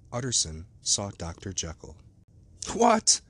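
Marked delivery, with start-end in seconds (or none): 2.7-3.2 high, loud cry of surprise; startled high-pitched exclamation; cried out. A narrator's reading. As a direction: call out sharply in astonished recognition. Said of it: "he cried".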